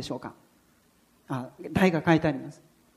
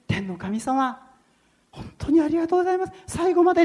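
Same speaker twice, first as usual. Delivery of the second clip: high, rushing thanks; grateful prayer; 11 semitones higher, faster than usual. Retold or imitated. Driver thanking God he made it through, high and fast. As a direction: pour out thanks to heaven.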